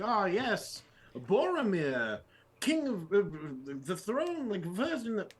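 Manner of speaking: high, mock-grand reciting; pompous mock lore recital. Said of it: "if I was like".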